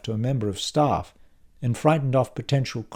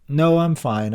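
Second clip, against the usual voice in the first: higher, louder brush-off; raised, quick reassurance; assuring; 6 semitones higher, 6 dB louder than usual. A narrator's reading. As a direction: insist you're all right. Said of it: "I assure him".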